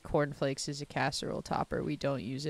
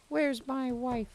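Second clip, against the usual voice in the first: high, drawn-out call; stretched singsong search for your wife; 8 semitones higher, slower than usual. Real, not acted. Repeats call, drawn out higher.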